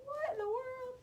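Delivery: put-on high, startled kid voice; groggy kid. Kids woken by the smoke alarm, high startled voice. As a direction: play a kid jolted awake. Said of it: "You're like".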